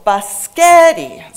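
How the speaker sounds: BISCOTTI as buh-SKET-ee, middle syllable long and loud; slow demo of "biscotti" without the first S, buh-SKET-ee; child's mispronunciation. Shown as a demonstration. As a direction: stretch and punch the middle of BISCOTTI. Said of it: "the center syllable was pronounced".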